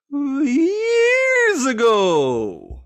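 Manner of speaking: high, drawn-out hammed-up wail; mock wail. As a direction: wail it like a fake cry. Self-mocking joke punchline.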